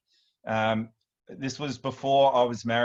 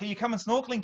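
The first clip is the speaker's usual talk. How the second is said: higher-voiced retold question from mum; mum's question; 10 semitones higher, faster than usual. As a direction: lightly imitate a mum asking, pitched up. Mum calling dad in retold story.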